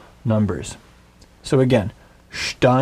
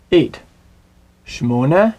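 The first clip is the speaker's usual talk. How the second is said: ordinary talk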